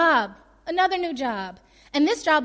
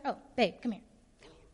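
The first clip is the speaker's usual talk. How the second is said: ordinary talk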